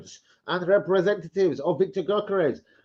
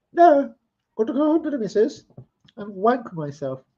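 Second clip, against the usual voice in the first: much higher put-on voice for the teammate's reply; mock teammate voice; 9 semitones higher than usual. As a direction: play the lads' answer in a much higher, silly voice, slowing at the end.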